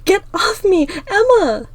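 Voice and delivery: high, loud cry to get free; urgent shout. Acted. Star fights to get free and calls Emma, urgent shout.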